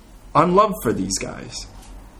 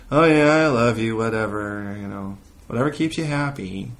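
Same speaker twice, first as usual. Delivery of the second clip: acted half-hearted, dismissive spouse; mock loveless affection; 6 semitones lower than usual. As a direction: say it as if you couldn't care less. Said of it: "where's the love in that".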